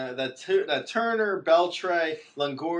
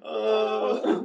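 mournful sad clown whine; 9 semitones higher, 4 dB louder than usual. Pretend. Mock crying continues.

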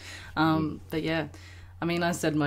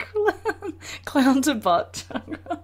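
laughing; 9 semitones higher, much slower than usual. Real, not acted. Repeating the joke, laughing.

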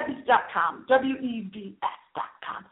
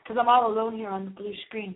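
usual speaking voice

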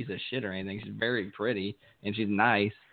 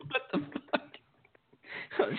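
laughing disbelief; 5 dB quieter, much slower than usual. Retold or imitated. His own reaction, retold laughing.

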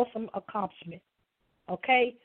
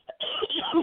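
cough; 6 semitones higher than usual. Real, not acted. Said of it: "excuse me, again".

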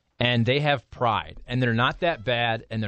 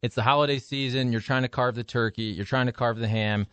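ordinary talk, nothing marked

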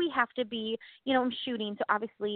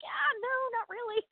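mock refusal; 11 semitones higher than usual. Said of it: "I'm like".

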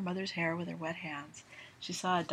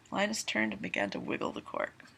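plain reading voice

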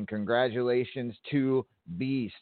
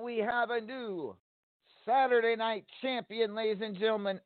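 excited announcement; 9 semitones higher than usual. Acted. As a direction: proclaim the new champion with excitement. Announces the new champion.

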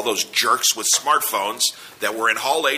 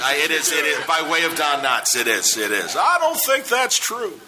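Mike Norton impression; 10 semitones higher than usual. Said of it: "Mister Haney from Green Acres".

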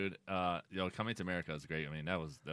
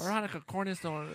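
usual speaking voice

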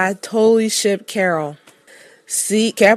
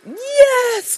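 very high, drawn-out squeal at normal volume; re-enacted excited scream. Fan reliving the moment Morgan appeared, re-enacted excited scream. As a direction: replay a fan's shriek of joy. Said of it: "I screamed. I was like".

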